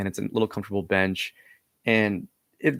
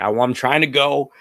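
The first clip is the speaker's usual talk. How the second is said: louder, higher, strained stammer; desperate plea; 5 semitones higher, 8 dB louder than usual. Retold or imitated. Cornered tripper pleading with the spirit that they are trying to leave. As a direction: push the words out in panic.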